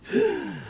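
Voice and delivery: high sudden gasp for air; sharp pained gasp. Acted. Inventor gasps as his heart fails. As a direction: catch a painful breath. Heart failing mid-speech.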